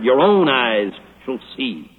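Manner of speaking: "own" stressed, low and slow; low, slow weight on "own"; emphatic word. Shown as a demonstration. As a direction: lean hard on "own". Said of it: "It's a very emphatic word".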